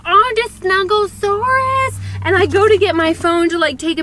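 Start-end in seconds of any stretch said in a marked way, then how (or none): 0.0-2.0 put-on high, slow baby talk to a dog; gushing pet voice, much higher and slow; cooing at a dog. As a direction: coo over the cute canine.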